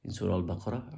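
ordinary talk, nothing marked

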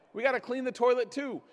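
usual speaking voice

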